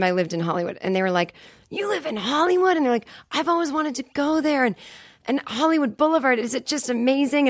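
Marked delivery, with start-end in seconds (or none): 1.7-7.5 high, gushing starstruck voice; breathless fan gushing; excited. Retold or imitated.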